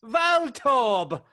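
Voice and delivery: high, slow, loud yelling; mocking the British accent with Pokemon; cartoon trainer shout. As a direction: call it out like a cartoon kid.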